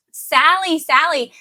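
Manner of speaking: high, lilting call of the name while showing the doll; sing-song name call. Shown as a demonstration. Parent holding the doll up to the face, high sing-song call. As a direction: call her name lightly, up high, like an invitation. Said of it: "instead you take the baby".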